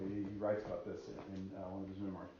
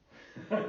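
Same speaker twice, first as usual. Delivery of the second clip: quick breathy laugh; 10 semitones higher, 5 dB louder than usual. Real, not acted. Laughing at the story.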